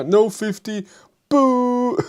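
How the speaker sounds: ordinary delivery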